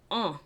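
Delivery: un said as a nasal vowel. Shown as a demonstration. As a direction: say un through the nose. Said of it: "the vowel".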